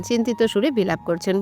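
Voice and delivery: plain reading voice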